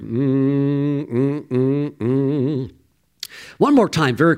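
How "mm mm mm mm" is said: repeated closed-mouth "mm"; drawn-out appreciative humming; savoring hum. Real, not acted. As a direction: hum as if relishing the moment. Savoring praise after the whoop.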